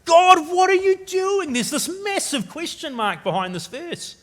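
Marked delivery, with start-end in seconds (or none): 0.0-1.5 high, loud, bewildered cry; crying out at God, aghast; anguished protest to God. Acted. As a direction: plead upward in disbelief. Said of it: "And the writer's saying".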